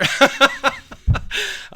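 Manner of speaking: high, loud burst of laughter; real laugh at his own act. Real, not acted. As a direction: crack up loudly. Laughs at own fake greeting.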